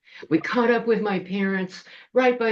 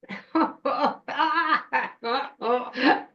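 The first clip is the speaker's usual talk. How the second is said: high troll-witch cackle; long gloating laugh in a put-on witch voice; 7 semitones higher than usual. Troll witch below the ledge, gleeful cackle.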